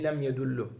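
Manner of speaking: yedullu with a final u; ending read as yedullu. Shown as a demonstration. Teacher giving the third reading, yedullu with final u. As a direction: close yedullu on a rounded u. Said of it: "üç şekilde de okunabilir".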